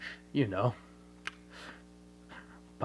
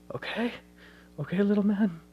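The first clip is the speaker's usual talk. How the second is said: much higher, pleading okay?; father begging the baby to keep the secret; anxious coaxing; 9 semitones higher, 4 dB louder, faster than usual. Retold or imitated. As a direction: coax the baby nervously, voice up high. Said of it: "his father's just going".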